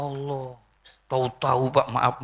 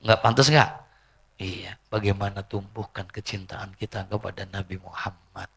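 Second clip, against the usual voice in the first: usual speaking voice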